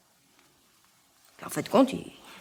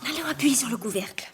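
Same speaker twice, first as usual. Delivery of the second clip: whisper; 6 dB quieter than usual. Acted. Pressing suitcase lid, whispering.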